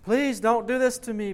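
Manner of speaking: high, loud desperate plea; pleading. A narrator's reading. A condemned man begging the Lord not to send him away, pleading loudly. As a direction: beg for mercy at the top of the voice. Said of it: "pleads the man".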